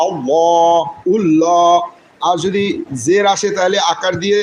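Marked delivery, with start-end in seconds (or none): 0.1-1.7 আল্লাহ and উল্লাহ with a thick, full lam; heavy lam. Shown as a demonstration. Teacher demonstrating thick lam: heavy. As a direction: fill the ল in উল্লাহ, heavy and dark. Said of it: "মোটা করে পড়তে হয়".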